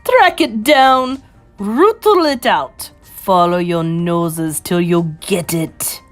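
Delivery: loud, slow commands dropping in pitch; imperious witch urging the coven; screamed orders. Acted. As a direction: drive the hunt on, word by word. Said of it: "screamed the Grand High Witch".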